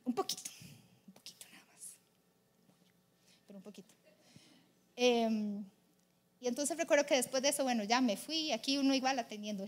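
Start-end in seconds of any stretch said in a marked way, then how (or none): 0.1-3.9 very soft, dropping almost to a whisper; barely voiced confession; hushed aside. Real, not acted. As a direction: murmur it as a small admission. Soft aside admitting impatience.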